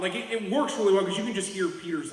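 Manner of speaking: normal talking voice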